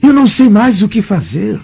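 voz bem mais aguda, implorando ajuda em desespero; súplica desesperada. Acted. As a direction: pede socorro à amiga, à beira do desespero. Marido aflito suplica ajuda à amiga. (marido desesperado implora ajuda a Calpurnia, voz aguda e aflita)

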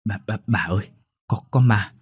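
put-on stammering servant girl, repeating the first words; frightened stammer. Servant girl stammers to her mistress about a ghost, frightened stutter. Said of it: "nó lắp bắp".

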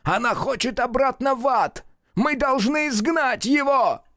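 высокий отчаянный крик; кричит в отчаянии. A narrator's reading. Мерл кричит Люку. (Мерл отчаянно кричит Люку)

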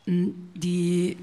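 usual speaking voice

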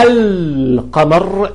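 القمر slowly with a long clear l; sounded lam. Shown as a demonstration. Teacher contrasting with the sun lam, القمر with audible l. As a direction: hold the l in القمر. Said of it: "اللام ظاهرة وعليها".

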